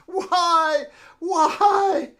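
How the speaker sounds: high, loud anguished cry of grief; anguished wail. Acted. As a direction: wail it out in despair. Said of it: "she falls to her knees and says".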